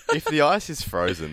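usual speaking voice

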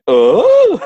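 high loud startled "Oh!"; surprised gasp. Retold or imitated. Eyes went, exclaiming shock.

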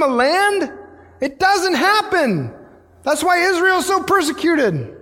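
high-pitched, urgent rhetorical questions; impassioned preaching. Real, not acted. Preacher marvelling that no other God does this, impassioned. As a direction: press the congregation with soaring intensity.

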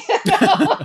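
real loud, high laughter; cracking up. Real, not acted. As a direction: burst out laughing around the words. Cracking up at her own story.

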